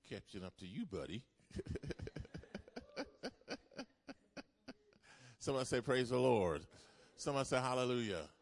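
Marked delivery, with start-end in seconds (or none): 1.5-3.8 high airy laugh; breathy laugh. Real, not acted. Teasing boss. 5.4-8.3 call to congregation. Acted. Call and response.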